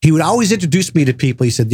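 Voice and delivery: ordinary delivery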